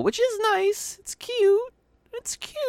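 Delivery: very high squeaky cutesy voice. Acted. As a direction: squeal it out like baby talk. Gushing over cute squirrel.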